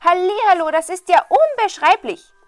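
high, loud, gushing voice; overexcited simpleton. A narrator's reading. Simpleton announces an even better find, wildly excited. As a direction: crow over your amazing find.

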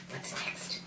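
hushed whisper. Acted. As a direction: whisper it like a secret. Whispered page turn.

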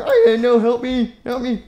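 high pleading cry; mock cry of a scared kid. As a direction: beg for help like a scared kid.

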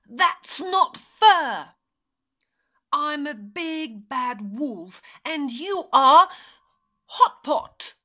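loud storybook wolf complaint; howling protest. A narrator's reading. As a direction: protest loudly as the aggrieved wolf. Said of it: "howled the wolf".

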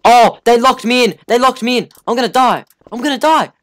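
high, loud panicked wail; scared horror game streamer. Real, not acted. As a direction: panic as the trap closes. Trapped in horror game room.

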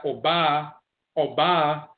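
"Oba" as aw-BAH, high on the first A; Asante tones. Shown as a demonstration. Lecturer explaining the high A, "Oba" as aw-BAH. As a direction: lift the long ah in "Oba". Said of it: "the first A is high".

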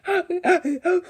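high, rapid acted gasps; gasping for breath. Shown as a demonstration. Showing viewers her crying after the chase, gasping. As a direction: pant quickly as if catching breath. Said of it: "trying to catch my breath".

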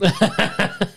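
squealing burst of laughter; high laugh. Real, not acted. Laughs at joke.

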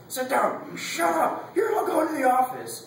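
high-pitched mock shouting; scolding teacher. Teacher yelling at kids goofing around, scolding. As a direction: shout orders in a shrieky teacher voice.

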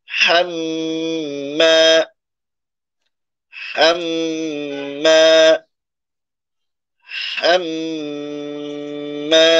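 হাম্মা very slow, long hummed m then stretched "maa"; ghunna and madd. Shown as a demonstration. Teacher joins the rules together, হাম্মা with humming m and drawn vowel. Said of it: "তাহলে মিলাই আমরা".

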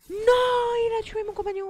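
high, loud anguished no; dismayed cry. Real, not acted. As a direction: cry out in shock. Companion falls in game.

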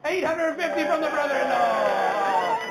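loud, drawn out and high; excited auction call. Real, not acted. Auctioneer announces the brother-in-law's bid, excited call. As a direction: sing out the bid to the whole room.

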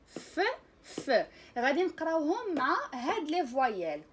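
ordinary talk, nothing marked

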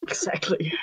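conspiratorial whisper. Real, not acted. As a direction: hush it knowingly. Whispered agreement.

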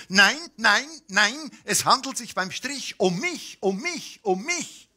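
thin stick man shouting his protest. A narrator's reading. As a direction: yell it like a thin man bumped into. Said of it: "dann schrie er".

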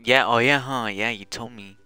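ordinary delivery

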